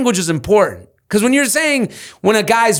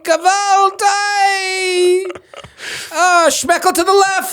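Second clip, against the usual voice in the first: put-on Yiddish accent; much slower than usual. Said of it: "hearing her go".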